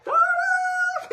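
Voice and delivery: sudden very high, very loud cartoonish shriek; Jim Carrey yell. Crafter imitating a movie star's excitement, sharp manic yell. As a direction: let out a manic comic scream. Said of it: "I feel like Jim Carrey".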